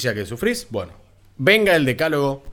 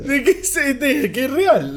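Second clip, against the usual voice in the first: squeaky high disbelief; high protest; 12 semitones higher, 3 dB louder than usual. Real, not acted.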